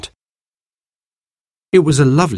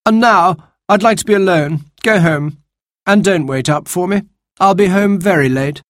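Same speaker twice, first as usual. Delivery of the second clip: higher, bossy voice; dismissive young man; 10 semitones higher than usual. Acted. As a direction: send them off haughtily. Baby dismisses siblings.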